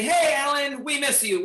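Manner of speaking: loud, high cheerful call; cheerful shout. Shown as a demonstration. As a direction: shout it out to a friend.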